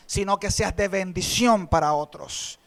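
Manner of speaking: ordinary talk, nothing marked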